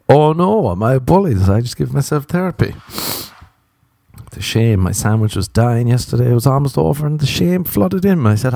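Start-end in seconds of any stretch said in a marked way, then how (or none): 0.1-2.7 mock alarm. Mock self-alarmed inner voice.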